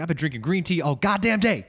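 high-pitched, worked-up movie quote; mock angry rant. Acted film line, ranting man.

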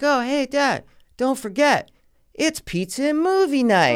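high-pitched mock daughter voice; excited daughter. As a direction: imitate a daughter chirping a reminder to her dad. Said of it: "she's like".

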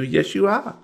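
high drawling waitress voice; waitress Wilma. Acted. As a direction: greet them like a folksy old diner waitress.

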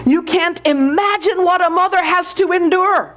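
high, eager insistence; excited. A narrator's reading. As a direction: insist excitedly, pitch lifted throughout. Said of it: "said the Lord excitedly".

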